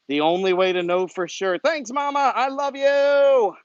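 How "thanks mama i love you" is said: higher, slow and loving; tender, drawn-out love to mom; tender thanks. Real, not acted. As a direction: tell your mom you love her. Message to his mother.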